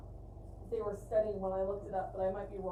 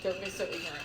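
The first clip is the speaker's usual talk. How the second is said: lower, mocking echo of her friend; teasing imitation, pitched lower; mock repeat; 6 semitones lower than usual. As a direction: parrot the line back in a lower mock voice.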